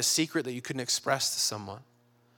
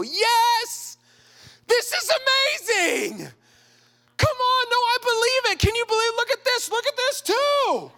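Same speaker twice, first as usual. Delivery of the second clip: very high, loud ecstatic squealing; hammed-up shrieks of joy; overjoyed; 5 dB louder than usual. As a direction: go wild celebrating a friend's miracle. Acted Elizabeth overjoyed for pregnant Mary.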